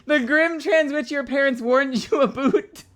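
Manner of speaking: high, loud, laughing quote of her own line; laughing through it. Real, not acted. Streamer laughing at her own signed print line. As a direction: giggle the words out loud. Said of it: "it kinda looks like I said".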